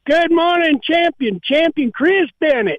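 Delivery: on-air caller greeting. Acted. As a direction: greet the host like a fan on air. Caller plays along on air.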